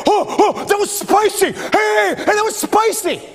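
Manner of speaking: high-pitched mock amazement; astonished caveman discovery. Preacher plays first man with taste buds, high excited yelps. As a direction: exclaim it like a startling first taste. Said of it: "and one dude went".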